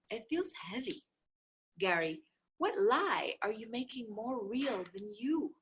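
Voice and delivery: ordinary delivery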